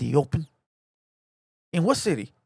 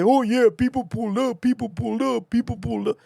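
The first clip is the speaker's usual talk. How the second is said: mock sing-song repetition; mocking imitation; 3 semitones higher than usual. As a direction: parrot their claim mockingly, over and over. Mimics opponent's repeated claim.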